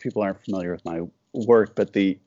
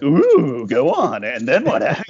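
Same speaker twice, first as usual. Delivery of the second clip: higher and louder, hammed-up keen interest; mock eager listener; 5 semitones higher, 5 dB louder than usual. Cartoonist playing a friend hooked on a mishap story, hammed-up eager. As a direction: lean in like a gossip hungry for more.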